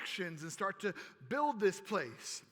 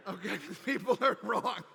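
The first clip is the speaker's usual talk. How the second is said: laughing through the words. Pretend. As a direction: tease them while laughing. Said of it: "I'm just playing".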